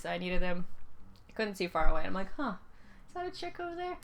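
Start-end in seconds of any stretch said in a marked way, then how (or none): 2.4-4.0 higher, puzzled musing; curious squinting wonder. Retold or imitated. Teen her without glasses eyeing the hallway, higher puzzled wonder. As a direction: wonder aloud, peering down the hallway.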